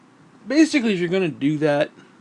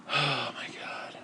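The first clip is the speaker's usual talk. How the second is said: soft, slow breathy whisper; whispered oh my God; despairing whisper; 2 semitones lower, 15 dB quieter, slower than usual. Real, not acted. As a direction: breathe it out, hopeless. Despairing sigh.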